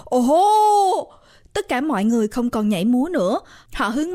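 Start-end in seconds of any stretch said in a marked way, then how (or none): 0.0-1.1 high, loud "oh-ho" of wonder; crowd amazement. A narrator's reading. Party crowd seeing a giant gift box, amazed. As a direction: draw out an amazed exclamation.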